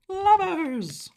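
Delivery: the word squealed way up high in sheer delight; a high, thrilled little shriek of joy. Real, not acted. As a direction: squeal it like you just got the card you wanted. Delighted at drawing the card.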